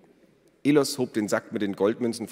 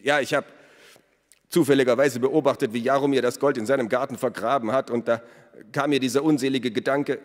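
higher-pitched, hurried confession; nervous accused smith; 5 semitones higher, 4 dB louder, faster than usual. A narrator's reading. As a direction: confess anxiously in a raised voice. (nervöser Schmied, höhere Stimme)

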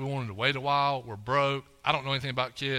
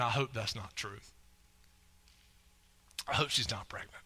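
inner voice; 4 semitones lower, 7 dB quieter than usual. Retold or imitated. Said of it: "in my mind, I'm saying".

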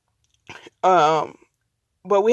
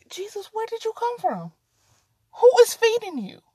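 much higher, coaxing the cat; 10 semitones higher than usual. Real, not acted. Talking to the stray cat in a high voice. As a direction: ask the stray who feeds it.

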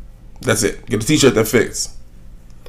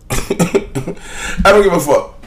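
slow, higher, laughing through it; laughing dismissal; 8 semitones higher, much slower than usual. Real, not acted. Shrugging off the [name] topic to listeners, laughing slowly. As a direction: laugh it off, stretching each word.

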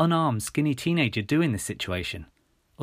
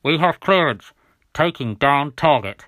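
loud, raised military radio voice; radio operator; 4 semitones higher, 8 dB louder than usual. A narrator's reading. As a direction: call it out loudly over the radio. Radio voice calls the shooting.

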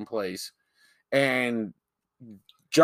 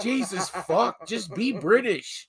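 exasperated; 7 semitones higher than usual. Real, not acted. Co-host begging the actors to be British, comically exasperated.

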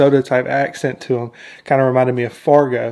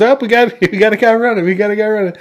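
higher-pitched, mock-urgent call; urgent alert; 8 semitones higher, 4 dB louder, faster than usual. Trooper alerts partner as one Jawa flees, urgent retold shout. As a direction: call out a runner, half laughing.

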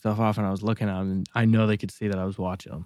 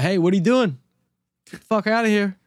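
high, raised-voice challenge; confrontational callout; 10 semitones higher, 4 dB louder than usual. Retold or imitated. Homeowner warning people looking in the windows, confrontational callout. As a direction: call out sharply at someone outside.

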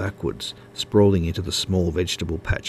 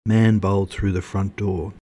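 ordinary delivery

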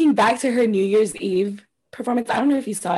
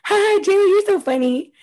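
mock [name]; 9 semitones higher than usual. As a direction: fake laugh, much higher and sing-song. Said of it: "And [name] going".

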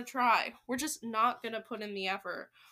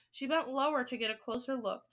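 plain reading voice

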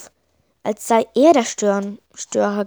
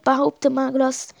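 plain reading voice

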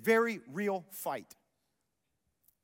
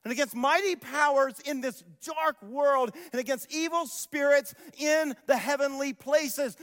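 loud, driving scripture read; preaching at full force; 7 semitones higher, 6 dB louder than usual. Acted. Pastor preaching Paul's words to the congregation, at full force. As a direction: thunder the verse to the church.

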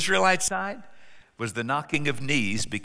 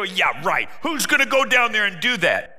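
sarcastic, higher scoff; scoffing soldiers; 5 semitones higher, 4 dB louder than usual. Acted. Soldiers scoffing at David's idea, sarcastic. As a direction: toss it off with a sneer.

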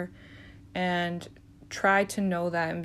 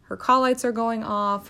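ordinary talk

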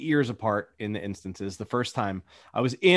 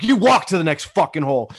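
angry dad order; 4 semitones higher, 7 dB louder, faster than usual. Retold or imitated. Dad snaps at son.

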